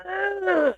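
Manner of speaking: high, drawn-out fake moan; mock moan. Acted sound during sex.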